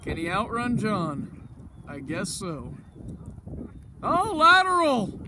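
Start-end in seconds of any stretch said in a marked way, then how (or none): 4.0-5.2 surprised shout. Real, not acted. Sudden play.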